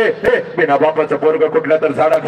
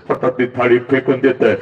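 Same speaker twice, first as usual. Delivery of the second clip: ordinary talk, nothing marked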